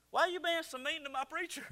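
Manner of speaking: high-pitched mock scolding from dad; upset dad. Dad takes son to task over his preacher, high mock scolding. Dad's phone complaint retold.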